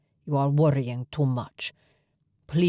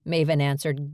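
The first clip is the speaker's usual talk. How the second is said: ordinary delivery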